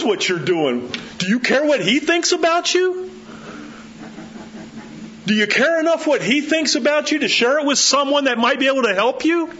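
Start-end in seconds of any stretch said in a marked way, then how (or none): 1.2-9.5 high, impassioned appeal; fervent pulpit challenge; preaching at full force. Acted. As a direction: press the question home fervently. Preaching challenge, much higher.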